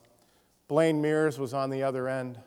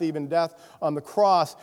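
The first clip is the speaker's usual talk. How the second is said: ordinary delivery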